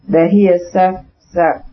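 stuck on "suf-", cut off twice; stammering. A narrator's reading. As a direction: stammer over "suf-" in a rush. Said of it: "began to stammer".

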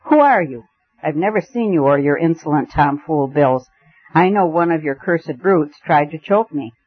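ordinary delivery, nothing marked

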